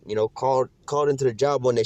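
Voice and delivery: ordinary talk, nothing marked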